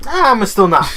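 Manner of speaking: stubborn customer. Pretend. Voicing stubborn late customer.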